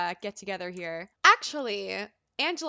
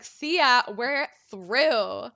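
loud, sassy kiss-off, pitched up; 7 dB louder, much slower than usual. Acted. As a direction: dump them with loud, playful sass. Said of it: "Look how sassy she is".

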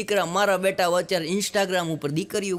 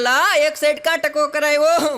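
surprised; 7 semitones higher, 5 dB louder than usual. Retold or imitated. Asks friend about half-shaved head.

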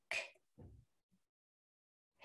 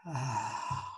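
long soft sighing ah through the mouth; audible exhale; 3 semitones lower, 9 dB quieter than usual. Shown as a demonstration. Leading a deep breath together, soft sighing exhale. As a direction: let the breath fall out as a sigh. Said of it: "Let it go".

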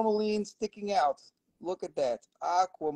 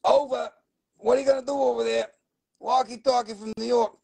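higher, louder mock radio caller; put-on walkie-talkie voice; 6 semitones higher, 3 dB louder, faster than usual. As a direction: play a New York walkie-talkie operator, pushed from the throat. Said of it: "it hurts my throat when I talk like that".

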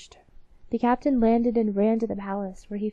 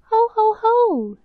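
high, loud hearty ho ho ho; jolly mouse laugh; 12 semitones higher, 9 dB louder, slower than usual. Acted. Three newcomer mice greet the queen with a loud high laugh. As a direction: laugh it out big and high like tiny travellers. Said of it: "said the newcomers".

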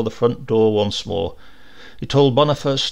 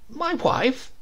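puzzled question; 12 semitones higher than usual. A narrator's reading. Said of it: "began to think".

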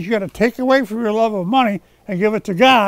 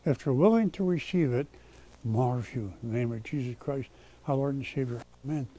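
low, soft, contrite prayer; hushed pastor's closing prayer; quiet prayer; 7 semitones lower, 10 dB quieter than usual. Real, not acted. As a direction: pray humbly, asking forgiveness, with halting pauses. Leads a repentant closing prayer.

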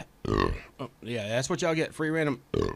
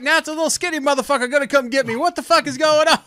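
loud, high reenacted outburst; retold alarmed yell; 9 semitones higher, 12 dB louder, faster than usual. Streamer retells being chased in game, exasperated yelling.

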